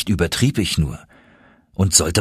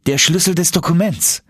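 higher youthful voice; young nephew voice; 9 semitones higher than usual. Acted. Nephew explaining to uncle, higher voice. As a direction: insist eagerly.

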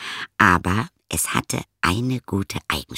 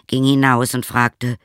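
ordinary delivery, nothing marked

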